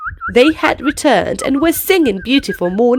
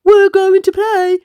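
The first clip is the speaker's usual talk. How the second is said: a bit higher and louder, cheerful; excited tiger; 7 semitones higher, 3 dB louder than usual. Acted. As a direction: call out the plan like an eager tiger. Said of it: "Tiger says".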